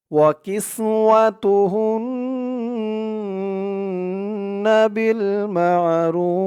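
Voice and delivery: slow, high, drawn-out melodic recitation; Quran recitation. Shown as a demonstration. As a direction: chant the verse, stretching each vowel. Teacher recites the verse aloud. (ধীর সুরেলা কুরআন তিলাওয়াত)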